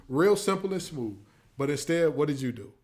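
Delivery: usual speaking voice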